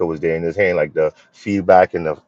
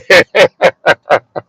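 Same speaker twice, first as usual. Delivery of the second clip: high laugh; 9 semitones higher, 7 dB louder than usual. Real, not acted. Laughing at his own joke to the viewer, high burst of laughter.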